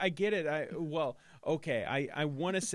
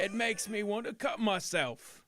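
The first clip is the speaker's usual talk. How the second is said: loud, high mock British talent-show judge; hammed-up British accent, damning verdict; Simon; 9 semitones higher, 6 dB louder than usual. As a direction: deliver a scathing judge's put-down in fake British. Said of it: "getting yelled at by Simon".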